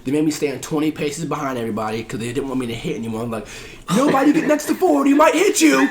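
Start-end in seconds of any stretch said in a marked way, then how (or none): 4.0-5.8 high, loud mock alarm; mock warning shout. Officers warning everyone away from Ford, mock alarmed shout. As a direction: shout a warning to the whole platoon.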